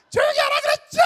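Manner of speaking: piercing, high-pitched full-force scream; head-voice shouting. Retold or imitated. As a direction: belt it like a metal vocalist. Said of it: "두성을 쓰고 있는데?".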